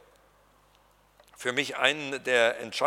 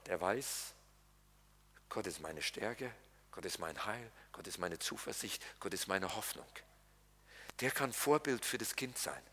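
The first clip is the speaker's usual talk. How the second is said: much lower and softer, intimate; quiet, lower confession of faith; hushed preaching; 6 semitones lower, 12 dB quieter than usual. Acted. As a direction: drop the voice to a near murmur. Hushed intimate sermon passage.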